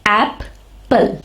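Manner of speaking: "Apple" as two separate beats, ap... ple; split into syllables. Shown as a demonstration. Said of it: "there are two syllables".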